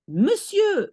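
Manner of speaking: Monsieur said high and French, muh-syeuh. Shown as a demonstration. Teacher modelling Monsieur to a student, French. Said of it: "instead of saying monsieur".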